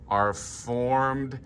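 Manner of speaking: normal talking voice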